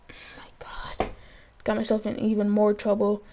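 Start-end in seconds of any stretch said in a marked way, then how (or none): 0.1-1.1 breathless whispered oh my God; whispered dread. Retold or imitated. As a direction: mouth it in a panicked whisper. Caught out by teacher.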